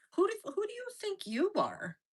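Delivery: high-pitched imitation of outraged scolding; mock indignant jury. Jury putting the defendant in her place, high mock indignation. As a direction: imitate jurors putting you in your place. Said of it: "They're like".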